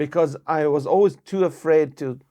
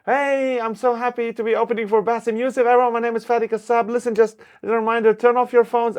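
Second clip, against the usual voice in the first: higher, louder, fast stage host voice; cheesy opening-act announcer, higher and louder; retold emcee announcement; 8 semitones higher, 4 dB louder than usual. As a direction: pump up the crowd like a show MC. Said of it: "I go".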